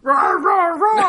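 playful monster roar; dinosaur voice. Pretend. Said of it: "Patience, it's your mic".

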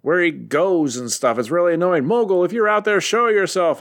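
upset Hogun voice. Retold or imitated. Host voices Hogun calling out his enemy, mock grief. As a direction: ham up Hogun's lament to the sky. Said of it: "He's like".

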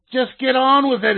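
loud, high, impatient condemned man; growling criminal. Acted. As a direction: bark it out, fed up with the fuss. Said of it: "he growled".

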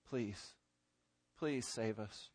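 low, hushed begging; quiet cry for help; humble, desperate plea. Acted. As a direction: plead very softly, pausing after please. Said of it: "desperate cry from somebody who knows".

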